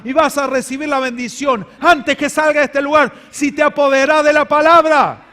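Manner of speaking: fervent climactic sermon; full-force preaching. Acted. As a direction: fire up the congregation.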